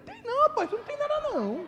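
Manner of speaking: very high-pitched retold doctor; emphatic reassurance. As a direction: insist animatedly, voice way up. Retold doctor insisting nothing is wrong.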